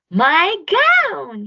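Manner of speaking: high, loud delighted shout; overjoyed to find his gown; joyful yell. A narrator's reading. George spots his old gown in the bag, shouting with joy. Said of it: "he yelled".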